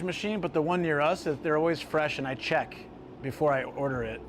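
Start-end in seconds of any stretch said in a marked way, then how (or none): none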